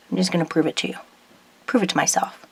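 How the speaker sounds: softly muttered to herself; quiet aside. Real, not acted. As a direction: drop the voice, half to yourself.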